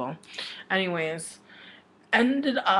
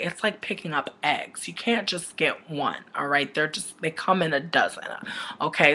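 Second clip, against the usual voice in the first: ordinary talk, nothing marked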